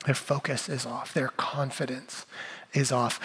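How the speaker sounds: quiet emphasis. Acted. Soft repeated sermon point.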